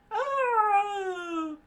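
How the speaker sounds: very high, loud scream; pained scream. Acted. Marine shot in the back, screaming.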